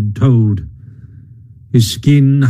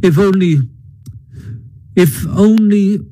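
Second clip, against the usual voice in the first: higher, hesitant and trailing into doubt; dubious; 8 semitones higher than usual. A narrator's reading. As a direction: leave it hanging, unconvinced. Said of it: "observed the Rat dubiously".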